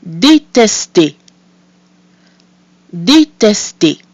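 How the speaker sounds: Détester said slowly, syllable by syllable; drawn-out French Détester, open middle E; careful French. Shown as a demonstration. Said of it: "I'll say the word twice".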